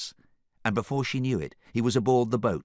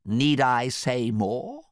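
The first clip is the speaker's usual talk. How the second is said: slow, weighty question; dramatic pause; 7 semitones higher, 5 dB louder, much slower than usual. A narrator's reading. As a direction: drag it out for effect. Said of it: "paused dramatically".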